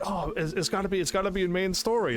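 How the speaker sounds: lightly voiced past self, sure of the plan. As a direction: replay your own misguided certainty. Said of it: "I was like".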